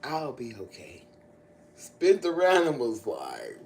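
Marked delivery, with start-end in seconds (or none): 0.0-1.0 quiet quote. Retold or imitated. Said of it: "he said".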